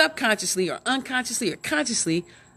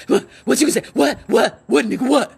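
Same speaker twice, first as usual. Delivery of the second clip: loud, higher, repeated mock challenge; mock aggressive; 4 semitones higher, 7 dB louder than usual. Shown as a demonstration. Showing how Germanic English sounds, mock aggressive. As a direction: act out harsh, confrontational demands. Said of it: "it's all aggressive".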